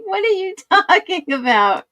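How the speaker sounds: high, incredulous retold question; confused disbelief. Retelling her confusion to chat, high and incredulous. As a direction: act baffled, pitch jumping up. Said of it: "I'm like".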